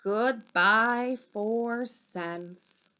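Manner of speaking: ordinary delivery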